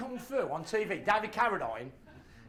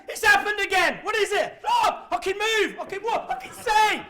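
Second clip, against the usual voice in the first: high, loud, overjoyed cries; bursting with joy at being alive; ecstatic shout; 10 semitones higher, 7 dB louder than usual. Pretend. Ecstatic imagined morning.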